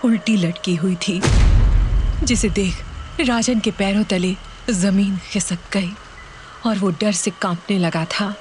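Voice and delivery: ordinary delivery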